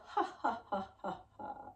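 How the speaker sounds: string of breathy ha's, fading out; staccato exhaled ha, tailing away; puffed ha breaths. Shown as a demonstration. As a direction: puff out ha in short pulses. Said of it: "and then as you exhale".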